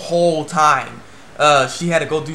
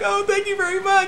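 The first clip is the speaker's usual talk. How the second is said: mock tearful thanks. Reviewer imitates someone crying at the handshake event, mock tearful squeal. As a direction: blubber thanks in an imitated high wail. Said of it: "he started crying too".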